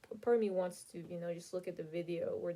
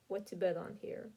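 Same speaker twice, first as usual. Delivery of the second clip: normal talking voice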